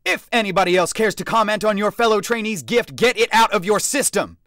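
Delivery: loud, high challenge to the room; shouted order. A narrator's reading. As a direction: dare them loudly. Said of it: "he ordered".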